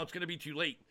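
ordinary talk, nothing marked

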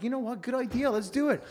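high, easy-going yes; giraffe agreeing. Acted. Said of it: "the giraffe's like".

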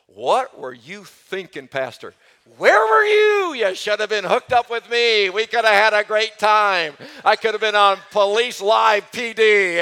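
high, loud, hammed-up cop voice; mock police officer. Pastor imitates the police officers in the congregation, with a loud hammed-up cop voice. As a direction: shout it like an eager cop wanting in on the bust. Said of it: "I know you're saying to yourself".

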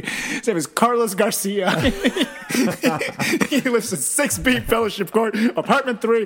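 high, loud mock panic; hammed-up frantic confession; panicked snitching. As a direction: blurt it all out, half laughing. Acting friend's panicked snitching, laughing.

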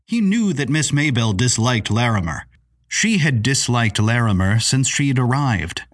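ordinary delivery

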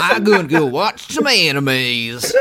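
folksy country drawl, slow. Acted. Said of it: "that's a redneck voice".

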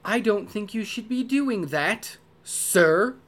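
a hint of a disapproving butler; polite reproach, then serve dinner; stern yet polite butler. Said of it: "you get both in every sentence".

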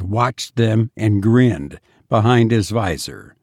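ordinary delivery, nothing marked

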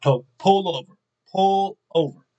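mildly alarmed wife giving an order; insistent wife. Retold or imitated. Wife to driving husband after his road rage, insistent.

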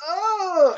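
high, sudden fainting gasp; psychic gasp. Retold or imitated. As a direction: cry out as if struck by psychic pain. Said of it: "some psychic power, like".

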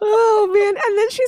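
high laughing fit. Real, not acted. Cracking up high while recapping the funny scene.

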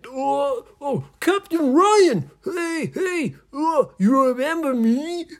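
high, loud, strained pirate voice; silly squealing pirate in a children's story; shouting pirate. Acted. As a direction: call down excitedly while being squeezed. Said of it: "the pirates were that were shouting".

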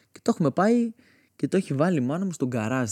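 normal talking voice

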